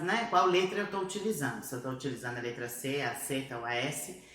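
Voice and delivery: ordinary talk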